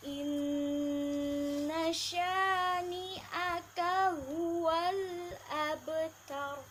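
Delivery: slow melodic tajwid chant; slow chanted Quranic recitation. Shown as a demonstration. Quran recitation, surah Al-Kautsar.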